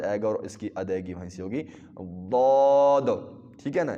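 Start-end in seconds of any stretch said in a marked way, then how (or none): 2.3-3.1 ضاد loud and heavy, tongue side on the molars; heavy ضاد, neither d nor z; full, emphatic ضاد; side-tongue daad. Shown as a demonstration. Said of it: "ضاد کی آواز".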